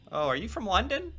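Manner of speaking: high, rising mock question; mock curious. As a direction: imitate a naive asker, lifting high at the end. Mocking a silly common question.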